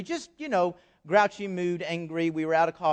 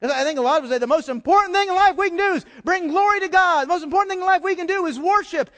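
preaching build-up; 10 semitones higher, 7 dB louder, faster than usual. Real, not acted.

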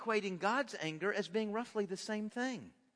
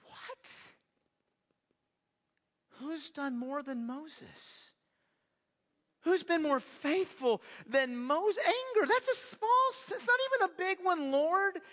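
indignant protest; 8 semitones higher than usual. Real, not acted. Preacher protesting to God, high and incredulous. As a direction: protest the unfairness with rising disbelief. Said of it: "And I'm, what?".

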